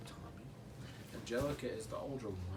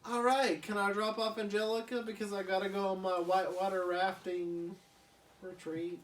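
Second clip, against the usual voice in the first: higher, louder eager uncle; Drew; 6 semitones higher, 3 dB louder than usual. Acted. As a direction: rush the favour cheerfully. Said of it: "he says".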